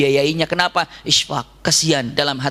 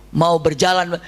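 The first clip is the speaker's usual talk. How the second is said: normal talking voice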